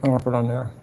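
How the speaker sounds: ordinary talk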